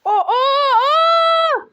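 loud, very high drawn-out "oh" cries; real panicked shouts, stretched long; alarmed yell. Real, not acted. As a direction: yell out as the car heads for a crash. Car about to crash.

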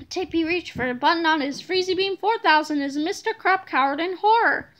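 ordinary delivery, nothing marked

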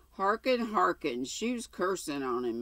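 ordinary delivery, nothing marked

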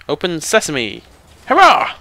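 triumphant cry, bursting loud and high at the end; triumphant call. Pretend. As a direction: call it out like a spell, then cheer. Incantation to open a puzzle door.

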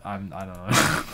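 ordinary talk, nothing marked

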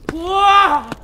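high, loud, open-throated holler; sudden full-voiced cry; loud "whoa" cry. Real, not acted. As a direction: let out a big strained "whoa". Straining on the climb.

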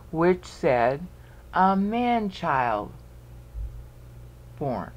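slow, spaced-out reading with pauses; counting out syllables. Shown as a demonstration. Teacher finishes counting the verse, slow and halting. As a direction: stretch and separate the syllables. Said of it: "count it all again".

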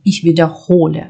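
wiederhole with the stress on HO. Shown as a demonstration. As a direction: lean on the O, Ich wiederHOle. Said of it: "падає на О".

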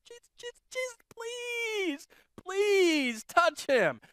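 high, hushed, pleading cry; begging Jesus in a hushed desperate voice. Acted. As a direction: plead breathlessly for Jesus to touch him.

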